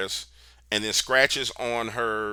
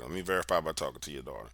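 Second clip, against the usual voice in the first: quieter repeated plea; 7 semitones lower, 11 dB quieter, faster than usual. Retold or imitated. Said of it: "I'm begging to talk to the daughter".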